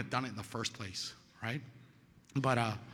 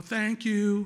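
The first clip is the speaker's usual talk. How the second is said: loud, high, drawn-out call; shouted thanks; 8 semitones higher, 8 dB louder than usual. Retold or imitated. Sponsor's evening prayer advice, shouted thanks. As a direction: holler it out of a window.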